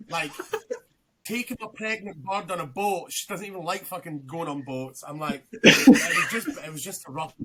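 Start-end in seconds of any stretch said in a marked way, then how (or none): 5.6-6.5 louder laughing through the words. Real, not acted. Laughing at her own bad day.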